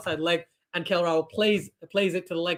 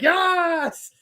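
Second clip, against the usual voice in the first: mimicking Babar's cheer; 10 semitones higher, 3 dB louder than usual. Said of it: "Babar's like".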